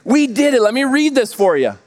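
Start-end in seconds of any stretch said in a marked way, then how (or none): 0.0-0.6 higher mock shout of victory; triumphant cheer. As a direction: cheer it like you just won. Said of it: "this back and forth conversation".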